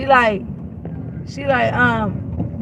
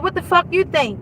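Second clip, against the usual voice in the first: mock snippy comeback, pitched up; snippy attitude; 6 semitones higher than usual. Imitating Brandy's snippy reply to the caller. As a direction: throw the line back with attitude. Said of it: "Why such, so much attitudes?".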